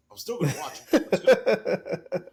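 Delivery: slow, loud, laughing. Retold or imitated. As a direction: laugh it out, drawn out. Quoting own reaction.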